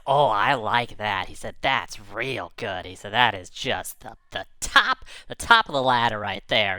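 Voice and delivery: slow, relishing praise; slow, satisfied verdict of a creature; savouring approval. Acted. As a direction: draw out each word with relish.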